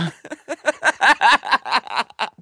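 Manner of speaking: high-pitched laughing fit. Real, not acted. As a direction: crack up high. Laughing at punchline.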